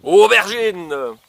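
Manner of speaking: slow, loud reveal of the answer; drawn-out reveal. Acted. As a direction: stretch the word out like a quiz host. Reveals answer to guessing kids.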